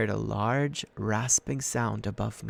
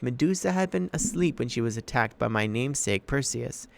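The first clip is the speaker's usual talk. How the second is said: ordinary delivery, nothing marked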